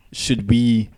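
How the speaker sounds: normal talking voice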